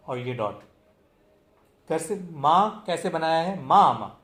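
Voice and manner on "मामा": मामा with loud first मा, falling short second; मामा modeled loudly with front stress; first-syllable stress. Shown as a demonstration. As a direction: punch the start of मामा. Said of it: "उसी को हम जोर से बोलेंगे".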